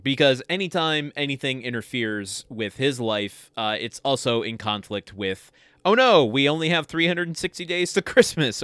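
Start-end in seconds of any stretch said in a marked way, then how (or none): 5.9-8.6 higher-pitched fretting, loud "Oh no"; lightly hammed-up worry; mock panic. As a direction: imitate someone fretting over a looming deadline. Acting out Scott's worry about Christmas deadlines.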